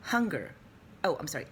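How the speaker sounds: ordinary talk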